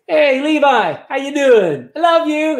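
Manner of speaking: high, bright and offhand, a throwaway hello; breezy casual greeting. Retold or imitated. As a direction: toss off a cheery hi and move on. Jesus greeting Levi too casually.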